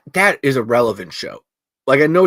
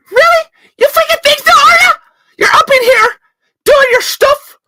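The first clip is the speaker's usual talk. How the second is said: screaming Irish rant; 10 dB louder than usual. Acted. Acted Irish row with Orla.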